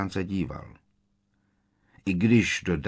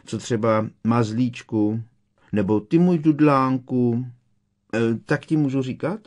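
higher, louder mocking lilt; sugary taunt, pushed louder at the end; teasing pet names; 5 semitones higher, 6 dB louder than usual. A narrator's reading. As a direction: tease with mock endearments.

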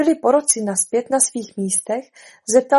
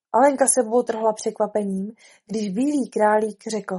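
ordinary delivery, nothing marked

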